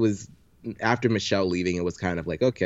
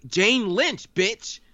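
high-pitched mock disbelief; incredulous outburst; 11 semitones higher, much slower than usual. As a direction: exclaim it as if you can't believe they missed it. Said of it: "she was so incredulous".

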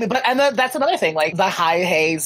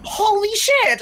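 mock shocked shout; much slower than usual. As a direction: burst out in hammed-up shock. Reacting to flood of messages.

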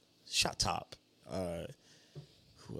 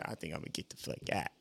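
fast, much softer mumbled [name] impression; 6 semitones lower, 12 dB quieter, faster than usual. Friend retells [name]'s walkout, quick soft [name] mumble. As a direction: rush it out under your breath like [name]. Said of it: "How he said it in his [name] voice".

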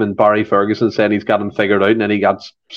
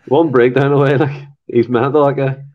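poorly faked Irish accent; bad Irish accent; 2 semitones higher than usual. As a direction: attempt an Irish accent and miss. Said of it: "That was a shite Irish accent".

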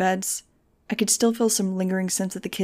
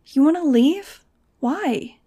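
high, loud startled question; a girl jolted awake by bad news; alarmed girl; 7 semitones higher, 5 dB louder, slower than usual. A narrator's reading. Nudge reacting to Max wanting to leave, startled.

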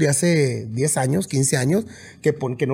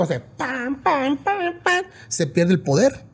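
higher pam pam that loses punch; mock band hits; 9 semitones higher, much slower than usual. Musician showing sound losing its power. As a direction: let the pams fall flat. Imitating weak wireless mic hits.

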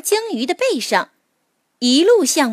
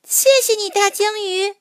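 put-on high, sweet child voice; grateful little mole; 10 semitones higher than usual. A narrator's reading. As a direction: thank him like a small child. Mole thanks the whale.

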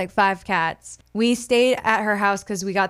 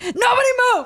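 much higher panicky mock voice; freaked-out Tucker; 3 dB louder, faster than usual. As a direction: imitate a boyfriend in a panic. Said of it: "he was freaking out".

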